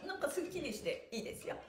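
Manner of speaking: ordinary talk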